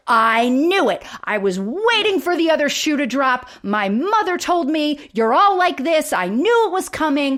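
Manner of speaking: hammed-up sitcom indignation; acted indignant outburst. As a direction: act out a mock-aggrieved "I knew it" rant. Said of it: "And he's like".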